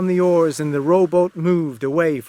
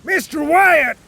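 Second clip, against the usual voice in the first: a high, loud call across the deck; shouted call; 13 semitones higher, 5 dB louder than usual. Acted. Captain calling Mister Wyatt from the rowboat, loud shout. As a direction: yell his name to stop him. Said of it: "shouted the captain".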